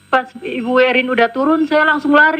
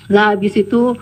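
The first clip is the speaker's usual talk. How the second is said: ordinary talk, nothing marked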